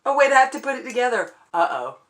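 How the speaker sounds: ordinary talk, nothing marked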